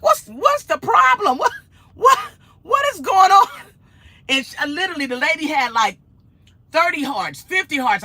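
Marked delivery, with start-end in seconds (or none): 0.0-3.5 high, loud, frantic questioning; stammering, high-pitched mock alarm; mock panic. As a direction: shriek it in hammed-up bewilderment. Retells frantic scrolling after screaming.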